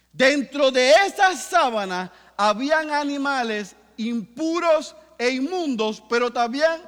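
loud fervent preaching, voice driving upward; preaching at full force. Acted. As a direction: preach it to the back row. (predicando con fuerza y fervor)

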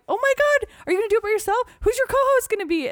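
high, loud excited squeal. Retold or imitated. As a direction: burst out in thrilled surprise. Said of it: "And I was like".

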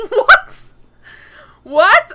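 loud, high-pitched shocked "what?"; incredulous. Retold or imitated. Podcaster reacting to the husband's excuse, incredulous. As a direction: blurt it in disbelief, half laughing. Acting out stunned reaction to quote.

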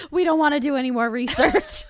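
real laughter breaking into the words; laughing protest. Real, not acted. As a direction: joke it out while laughing. Joking refusal through laughter.

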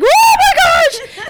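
very high, drawn-out mock scream; retold freaking out. Whole family reacting to the graveyard news, hammed-up shriek. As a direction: shriek it long and loud, half laughing. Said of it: "everyone was freaking out".

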